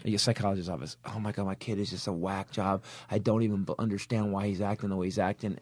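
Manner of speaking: whiny parent. Retold or imitated. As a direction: mope it out, exasperated. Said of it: "a parent comes in and goes".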